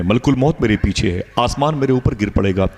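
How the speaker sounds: usual speaking voice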